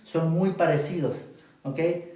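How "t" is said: ordinary talk, nothing marked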